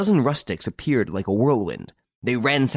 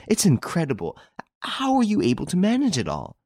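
higher, fuller amazed outburst; bewildered; 10 semitones higher than usual. A narrator's reading. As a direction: wonder spilling into a question. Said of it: "she repeated, utterly bewildered".

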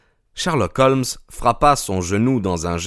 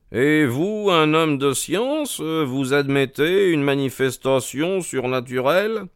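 raised-pitch disbelieving question; incredulous challenge; 6 semitones higher than usual. Acted. Holmes challenges a man of science on the supernatural, incredulous.

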